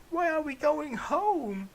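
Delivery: very high, slow squeaky question; high mock questioning. Son asking father in the car, in a slow squeaky voice. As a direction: ask it in a silly high squeak. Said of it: "I questioned".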